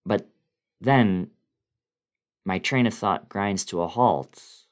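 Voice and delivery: ordinary delivery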